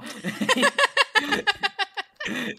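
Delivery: very high shrieking laughter. Real, not acted. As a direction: burst out in shrieks. Big laugh.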